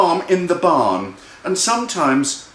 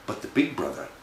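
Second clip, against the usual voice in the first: usual speaking voice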